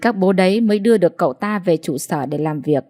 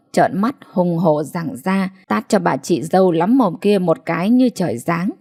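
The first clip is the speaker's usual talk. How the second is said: ordinary delivery, nothing marked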